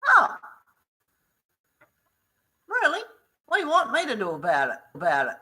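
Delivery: loud, high, fast indignant retort; angry retort. Retold or imitated. To the staff complaining about Bianca, a loud indignant shout. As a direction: fire it back at them, shouting.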